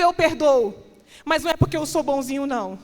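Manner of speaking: high, loud and ringing, climbing toward the top; full-force preaching. Acted. Preacher voicing Paul's forgiveness to the church, loud. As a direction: thunder it out like a revival sermon.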